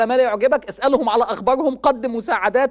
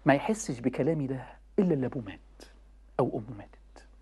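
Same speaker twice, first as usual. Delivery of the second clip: hushed whisper; 11 semitones lower, 8 dB quieter than usual. Real, not acted. Preacher whispering to grieving listeners. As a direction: whisper it, barely voiced and lower. Grief over a dead parent.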